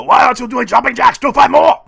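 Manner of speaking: high, fast bellowed strongman impression; Arnold. Imagined bodybuilder demanding jumping jacks, frantic shout. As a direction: yell it like an Austrian drill sergeant. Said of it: "this is what I imagine in my head".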